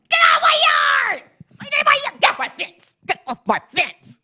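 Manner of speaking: very high, loud screech, fading toward the end; cranky lady shout. Retold or imitated. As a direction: screech at kids like a crabby neighbor. Cranky lady voiced yelling at kids.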